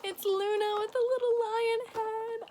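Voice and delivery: high, loud excited squeal; delighted squeal. Real, not acted. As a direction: squeal with joy at a surprise gift. Opens a long-wanted Funko gift.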